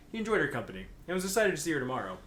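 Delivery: normal talking voice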